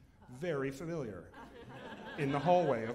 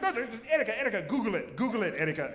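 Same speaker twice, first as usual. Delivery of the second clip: mock insisting; 6 semitones higher, 6 dB louder, faster than usual. Mother-in-law insisting, laughing.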